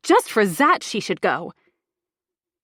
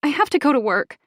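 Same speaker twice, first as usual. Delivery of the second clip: higher, louder whiny complaint; whining; 5 semitones higher, 5 dB louder than usual. A narrator's reading. Narrator tells housemate about going to work, whining. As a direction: keep whining through the excuse. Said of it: "I whine".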